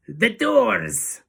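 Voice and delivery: loud, high ringing shout; Grand High Witch. A narrator's reading. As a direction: shout to fill the room with a guttural accent. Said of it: "shouted the Grand High Witch in a voice that filled the room".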